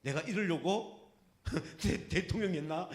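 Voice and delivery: slow, laughing mock of a famous line; Park Geun-hye quote. Preacher to the congregation, mocking Park Geun-hye's lament, slow and laughing. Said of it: "박근혜 얘기한 거 있죠".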